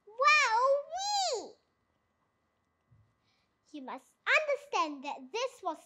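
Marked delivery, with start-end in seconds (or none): 0.1-1.6 delighted. A narrator's reading. Small fox tasting cider.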